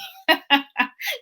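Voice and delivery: high burst of laughter; amused laugh. Real, not acted. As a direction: laugh at your own plea. Laughs while retelling her own request.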